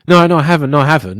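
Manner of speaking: quick insistent denial, imitating a passenger; mock-protesting passenger caught out; retold denial. Passenger denying she cancelled, acted out.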